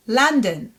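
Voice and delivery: London as LUN-dn, open first vowel; English "London", reduced second vowel; English pronunciation. Shown as a demonstration. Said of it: "Does it sound familiar?".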